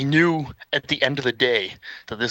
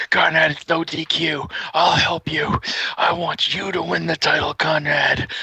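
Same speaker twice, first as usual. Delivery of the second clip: raised, urgent voice egging him on; scheming helper; 5 semitones higher than usual. Retold or imitated. Friend holds narrator for Conrad to hit, urging him on. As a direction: play the accomplice urging a wrestler on.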